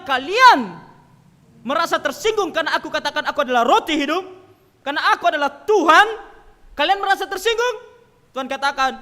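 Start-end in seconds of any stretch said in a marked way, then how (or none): 0.0-7.8 loud, high indignant question; raised, incredulous preaching voice; confronting challenge. Retold or imitated. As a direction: call them out sharply. Jesus confronting offended disciples.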